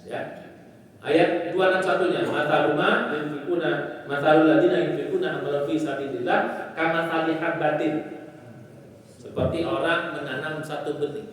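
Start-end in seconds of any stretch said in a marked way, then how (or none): 2.2-7.9 Quran recitation. Acted. Preacher reciting the verse to his listeners, measured and reverent. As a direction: intone the Arabic verse reverently.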